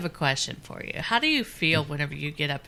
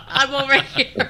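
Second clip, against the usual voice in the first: laughing; 9 semitones higher, 9 dB louder than usual. Real, not acted. Laughing at guest's yawn.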